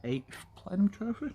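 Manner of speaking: hushed proud whisper. Real, not acted. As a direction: breathe it out like a secret. Proud gaming boast, whispered.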